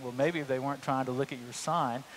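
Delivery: soft, dry aside to yourself; quiet sarcastic muttering; wry inner thought. Retold or imitated.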